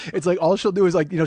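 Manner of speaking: usual speaking voice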